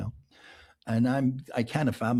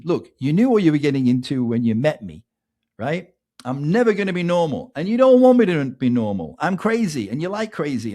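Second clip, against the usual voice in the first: louder, defiant declaration; 4 semitones higher, 8 dB louder than usual. Retold or imitated. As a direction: own it loudly, daring him to object. Tamaz's defiant declaration retold.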